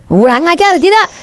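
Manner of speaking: high-pitched challenge to the crowd; rallying call. Real, not acted. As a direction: throw the question out high, demanding an answer. (seruan tinggi menuntut jawaban jemaah)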